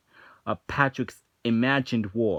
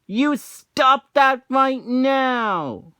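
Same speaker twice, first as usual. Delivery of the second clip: much higher, louder, slow furious yell; mock angry yell; 13 semitones higher, 6 dB louder, much slower than usual. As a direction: bellow it like an enraged SpongeBob. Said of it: "after he said".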